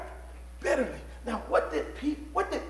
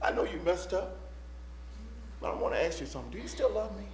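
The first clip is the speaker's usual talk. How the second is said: gentle forgiving question; 2 semitones lower, 8 dB quieter, faster than usual. Acted. Jesus to Peter who messed up, gentle and forgiving. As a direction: ask it softly, with room between phrases.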